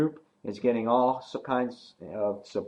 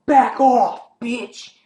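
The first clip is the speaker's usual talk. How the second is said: much higher, much louder snarling yell; angry yell; 13 semitones higher, 10 dB louder, much slower than usual. Retold or imitated. Nicholas yelling at Olivia, much higher, much louder. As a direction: bark it at her to back off. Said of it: "and he's like".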